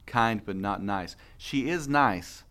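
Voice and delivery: very slow, each word weighted; slow careful emphasis; slow emphatic clarification. Real, not acted. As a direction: spell out the point deliberately. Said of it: "I would like to clarify".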